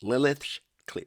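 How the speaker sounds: usual speaking voice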